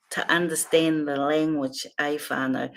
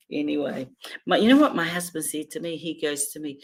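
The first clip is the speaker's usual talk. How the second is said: usual speaking voice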